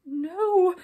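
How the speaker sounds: high, loud cry of alarm; frightened cry. A narrator's reading. Brownies facing the king's threat, frightened cry. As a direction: blurt it out in fright. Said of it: "very frightened indeed".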